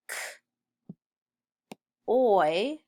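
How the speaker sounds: bare "K", long pause, then "oi"; sounded out. Shown as a demonstration. Teacher sounding out coil as pupils write, sounded out. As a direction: separate the k from the oi sound. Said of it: "O I says oi".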